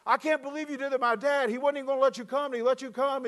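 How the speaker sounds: put-on high, amazed boy's voice; a son marvelling at his dad; kid voice. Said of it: "my boy David goes".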